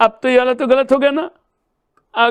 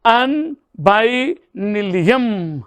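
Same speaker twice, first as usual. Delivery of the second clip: slow spelling out; much slower than usual. Shown as a demonstration. Teacher builds element name slowly.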